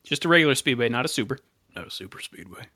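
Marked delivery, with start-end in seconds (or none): 1.7-2.7 much lower, far softer muttered aside; quiet aside. Real, not acted. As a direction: drop it to a near-whisper. Muttered aside after correction.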